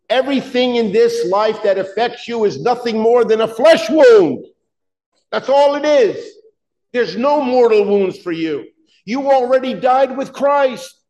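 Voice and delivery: high, loud preacher's build on "wound"; preaching. Acted. Pastor rousing his congregation, full-force preaching. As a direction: drive it home from the pulpit.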